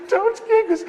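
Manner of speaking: put-on high crying wail of a scared kid; mock terrified crying plea. Brother begging Dad before the spanking, high crying wail. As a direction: wail it like a child about to be spanked. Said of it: "would start screaming".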